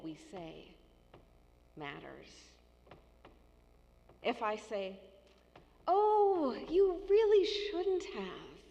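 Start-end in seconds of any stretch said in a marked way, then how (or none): 5.9-8.6 high, loud, drawn-out delighted thanks; grateful, pleased. Shown as a demonstration. Thanking a friend for a kind gift, delighted and gushing. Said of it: "it means you didn't need to do that kind thing for me, but I'm sure glad you did".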